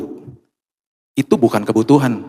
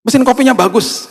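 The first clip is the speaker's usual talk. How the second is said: admiring; 12 semitones higher than usual. Retold or imitated. Praising the machine aloud.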